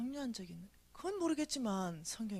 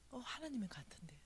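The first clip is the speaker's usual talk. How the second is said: whispered thought to oneself; hushed realization; 3 semitones higher, 14 dB quieter than usual. Retold or imitated. Speaker thinking it may be God, whispering. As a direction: whisper it, tentatively recognizing who speaks.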